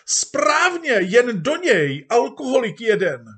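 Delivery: very high, loud egging-on shout; crowd shouting. Acted. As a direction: cheer the speaker on as a mob. Crowd cheering on the speaker. (dav hlasitě povzbuzuje)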